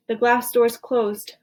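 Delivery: ordinary delivery, nothing marked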